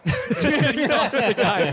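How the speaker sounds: cracking up, high and drawn out; slow, high-pitched giggling narration; laughing. Real, not acted. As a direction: narrate through helpless laughter. Narrator cracks up mid-sentence.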